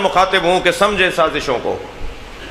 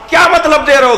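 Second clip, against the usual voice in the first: high, indignant rhetorical question; pitched-up protest from the pulpit; indignant challenge; 9 semitones higher, 5 dB louder than usual. Real, not acted. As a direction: challenge them with a raised, higher voice.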